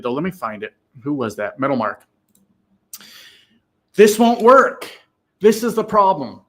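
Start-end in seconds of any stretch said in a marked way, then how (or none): 3.9-6.4 loud, high shout on won't work; excited yelling. Real, not acted. Streamer fired up at Metal Mark's comment, shouting. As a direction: belt it out, fired up. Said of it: "I'm getting a little excited".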